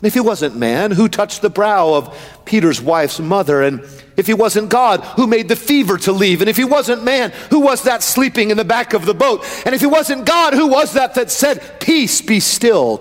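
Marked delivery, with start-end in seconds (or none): 3.6-13.0 driving, rhythmic pulpit questions; impassioned sermon chant; full-force preaching. Real, not acted. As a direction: preach it as a relentless rolling litany.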